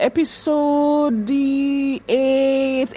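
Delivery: very slow, high, stretched syllables; drawn-out recall. Real, not acted. Reactor works out which episode it was, drawn out. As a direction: hold each syllable long and high.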